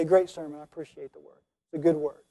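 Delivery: low, very soft, offhand compliment; mock polite praise. Churchgoer patting the preacher on the back, mock polite murmur. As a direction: murmur empty praise as an unmoved churchgoer would. Mimics an unmoved sermon praiser.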